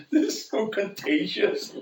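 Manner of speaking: breaking into laughter while talking; laughing. Real, not acted. Preacher recalling a woman's contagious laugh, laughing as they speak.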